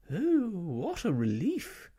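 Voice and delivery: high, slow, relieved sigh of a fussy lady; sighing with relief. Acted. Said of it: "sighing loudly".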